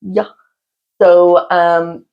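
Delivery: normal talking voice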